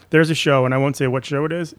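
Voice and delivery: ordinary talk, nothing marked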